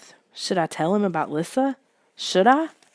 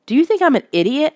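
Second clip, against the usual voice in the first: higher, louder, fast outburst; crying out; 8 semitones higher, 5 dB louder, faster than usual. A narrator's reading. Boy shouting at Lissa, higher and louder. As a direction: hurl it at her in disbelief. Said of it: "I cried".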